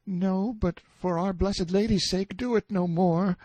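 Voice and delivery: put-on very high, pleading boy's voice; frightened pleading. A narrator's reading. Boy begs prisoner never to laugh so, frightened. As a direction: implore him fearfully, high-pitched.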